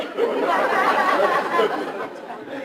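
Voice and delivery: a big, loud burst of laughter; joyful burst of laughter at the ceremony; hearty laugh. Real, not acted. Flags handed over, crowd laughing.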